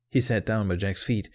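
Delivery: plain reading voice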